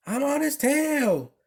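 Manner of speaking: high, determined declaration; declared. A narrator's reading. Missus Weatherby joining the chase, high determined declaration. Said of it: "declared Missus Weatherby".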